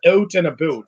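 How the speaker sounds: Canadian "oot and aboot"; mock Canadian accent. Quoting a Canadian actor, mock Canadian accent. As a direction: imitate a Canadian accent on the vowels. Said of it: "Ryan Reynolds is Canadian".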